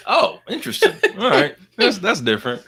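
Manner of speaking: high, loud laughing oh; surprised laugh. Real, not acted. Reacting to the teen's answer, loud high laughing surprise. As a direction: burst out amused and surprised.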